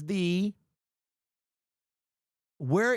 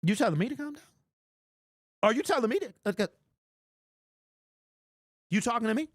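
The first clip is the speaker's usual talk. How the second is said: incredulous mock outrage, raised pitch; mock offended; 5 semitones higher, faster than usual. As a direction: play it up like a De Niro "you talking to me". Mock offense at chat's calm down.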